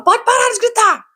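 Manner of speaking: high-pitched, drawn-out yell; acting out a screechy outburst; yelled order. Shown as a demonstration. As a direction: demonstrate shouting someone down. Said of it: "Geralmente eu sou uma pessoa que grita".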